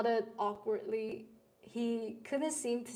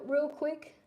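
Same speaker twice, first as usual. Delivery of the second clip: usual speaking voice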